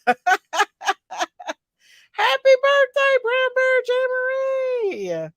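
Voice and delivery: high sing-song birthday greeting; excited birthday call. Pretend. Sung birthday greeting shout.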